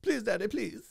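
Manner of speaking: high whiny pampered plea; fancy rich kid voice. Acted. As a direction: spoilt kid begging Daddy. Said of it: "fancy voices".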